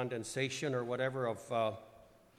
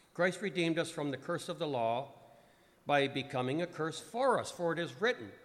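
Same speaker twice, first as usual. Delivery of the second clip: scripture reading; 3 semitones higher, 6 dB louder than usual. Acted. Reads Paul's letter aloud.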